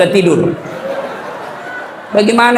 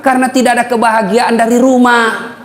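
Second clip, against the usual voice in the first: normal talking voice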